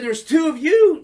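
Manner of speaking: high, loud complaint; indignant protest. Retold or imitated. Complaining to the rival queen, indignant. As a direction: protest the unfair odds.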